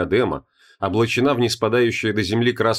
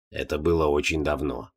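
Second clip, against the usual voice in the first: put-on low, soft, dry old voice; dry old man; 7 semitones lower, 5 dB quieter than usual. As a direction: say it drily, low. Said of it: "сухо сказал слепой старик".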